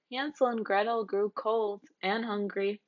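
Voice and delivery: ordinary delivery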